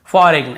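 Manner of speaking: फॉरेन misread as "fo-reg-n"; G wrongly sounded. Shown as a demonstration. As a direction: put a hard G before the final N of फॉरेन. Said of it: "ऐसे नहीं पढ़ने में".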